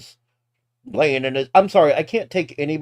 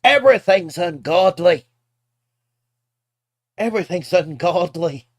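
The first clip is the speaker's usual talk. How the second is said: mock outrage; 6 semitones higher, 6 dB louder than usual. Mocking the preacher video.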